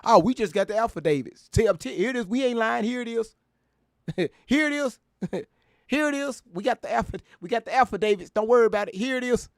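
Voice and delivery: high, sing-song mockery of liars; mimicking accusers waving papers, high and mocking; mocking impression. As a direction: whine it like a fake excuse.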